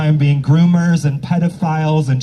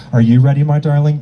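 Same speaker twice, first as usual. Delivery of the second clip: usual speaking voice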